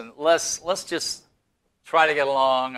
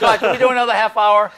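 laughing, quick, louder plea to heaven; mock prayer to God; 6 semitones higher, 7 dB louder, faster than usual. Host jokingly prays to God for extra half hour, laughing plea. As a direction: beg God for more airtime, half laughing. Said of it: "Ask God".

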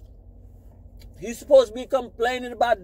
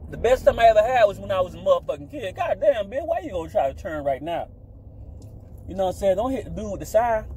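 ordinary talk, nothing marked

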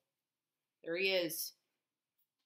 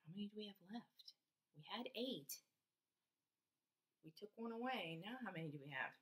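whisper; 14 dB quieter, faster than usual. Real, not acted. Whispered counting question.

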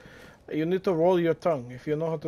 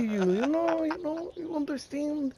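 normal talking voice

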